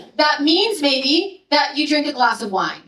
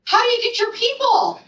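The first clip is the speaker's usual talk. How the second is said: incredulous question; 9 semitones higher than usual. Retold or imitated. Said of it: "they were like".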